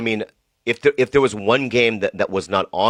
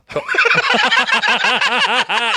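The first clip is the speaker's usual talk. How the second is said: high laugh; 3 dB louder than usual. Real, not acted. Laughing at the joke.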